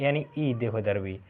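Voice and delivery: ordinary delivery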